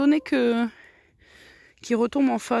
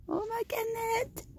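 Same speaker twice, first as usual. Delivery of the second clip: very high, soft, slow coo; high soft pet coo; cooing to a hen; 13 semitones higher, 8 dB quieter, slower than usual. Real, not acted.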